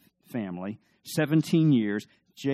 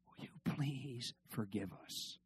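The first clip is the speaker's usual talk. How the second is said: much softer, slow pleading; humble plea; 15 dB quieter, slower than usual. Retold or imitated. The brothers begging Joseph for forgiveness, much softer and slow. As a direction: ask forgiveness with a lowered voice.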